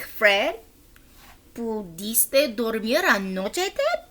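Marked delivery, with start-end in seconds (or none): none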